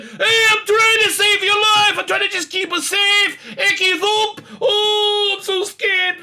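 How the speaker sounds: very high, loud mock wail; hammed-up panicky squeal, slow and over the top; terrible David Tennant. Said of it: "doing a terrible, terrible, uh, uh, uh, was it, uh, Tennant impersonation?".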